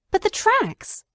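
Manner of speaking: high sharp objection; crying out. Acted. Watson objecting to Holmes about the tracks, high cry. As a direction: protest in amazement. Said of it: "I cried".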